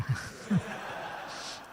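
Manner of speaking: soft, breathy chuckle. Real, not acted. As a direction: laugh quietly at your own remark. Chuckles at his own film remark.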